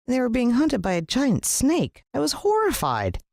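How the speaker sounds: high, horrified gasp of words. A narrator's reading. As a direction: sound truly shocked. Narrator reacts in horror.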